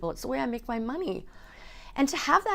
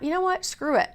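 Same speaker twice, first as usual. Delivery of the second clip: higher, bold screw-it voice; defiant self-talk; 9 semitones higher than usual. Retold or imitated. Self-talk pushing through nerves.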